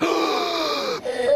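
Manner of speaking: long, sharp intake of breath; big gasp. Acted. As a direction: suck air in, aghast. Stan alarmed by wife's warning.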